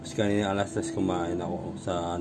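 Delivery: usual speaking voice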